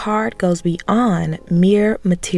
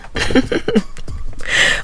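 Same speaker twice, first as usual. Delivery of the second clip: breathy laugh; 6 semitones higher than usual. Real, not acted. Laugh after coffee joke.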